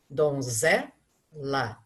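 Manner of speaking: "Donzela" very slow, low, weight on "ze"; stressed syllable shown. Shown as a demonstration. Teacher pointing out the tonic syllable, slow and low. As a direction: lean on "ze" in "Donzela", slowly. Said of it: "É o zé".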